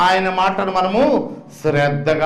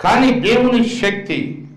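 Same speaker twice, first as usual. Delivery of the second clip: slow emphasis; 4 semitones higher, slower than usual. Acted. Preacher stressing God's power.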